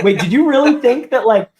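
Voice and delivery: loud, incredulous challenge; retold disbelief. Wrestler confronts his sulking tag partner, loudly incredulous. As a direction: raise the voice as if baffled by a friend.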